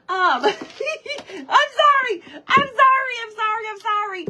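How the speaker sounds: high, giggling sorry; laughing apology. Real, not acted.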